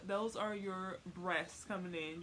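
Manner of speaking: ordinary talk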